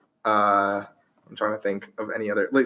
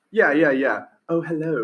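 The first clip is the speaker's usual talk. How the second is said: higher, hammed-up British accent; mock British accent; 5 semitones higher, 3 dB louder than usual.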